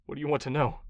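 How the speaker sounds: quiet, beaten-down question; defeated. Acted. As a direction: give in, all fight gone. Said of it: "his resolve seemed to melt away".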